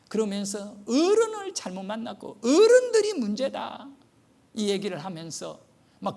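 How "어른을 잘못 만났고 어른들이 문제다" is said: high, louder indignant outburst; retold furious official; angry official. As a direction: scold the adults on the boy's behalf. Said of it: "완전히 화가 나가지고요".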